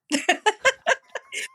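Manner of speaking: bursts into a high delighted laugh. Real, not acted. Laughing high as she recalls husband's camper stunt. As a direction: laugh high at your own story.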